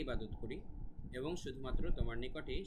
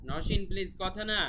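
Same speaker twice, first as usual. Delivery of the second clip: raised-voice scolding; 7 semitones higher, 7 dB louder than usual. Real, not acted. Teacher breaking off the lesson to hush someone, raised voice. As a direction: call out to hush someone nearby. Hushing a child named off-lesson.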